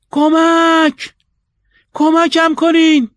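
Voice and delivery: very high, loud, drawn-out scream; cry for help. A narrator's reading. Man locked in his flat screaming to the street, in a drawn-out cry for help. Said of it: "فریاد زد".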